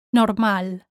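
Normal as nor-MAL; stress on A. Shown as a demonstration. Teacher models for learners, Normal stressed on the A. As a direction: punch the A in Normal, Spanish style. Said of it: "stress falls on the A".

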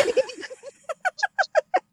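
very high, long giggle; self-mocking laugh. Real, not acted. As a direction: dissolve into high-pitched giggles at yourself. Laughs at herself.

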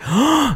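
sharp, high intake of breath; shocked gasp. Retold or imitated. Storyteller reacting to a stranger's insult, shocked gasp.

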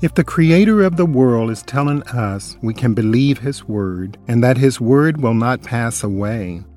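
normal talking voice

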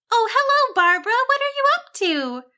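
very high, singsong sheep voice; sweet. A narrator's reading. Cassandra greets her friend Barbara, sweetly. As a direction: greet sweetly, pitched right up. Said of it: "Cassandra asked sweetly".

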